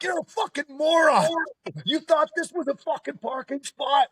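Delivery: retold scream. Recalling screaming at the Tesla driver, high mock yell. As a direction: bark it like you're losing it at him, retold. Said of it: "I screamed at this guy".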